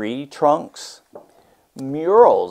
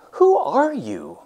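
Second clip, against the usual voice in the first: put-on much higher eager child's question; curious girl; 11 semitones higher, 3 dB louder than usual. Myra asks the painter who he is, high curious child voice. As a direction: ask it wide-eyed like Myra. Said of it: "Myra asked".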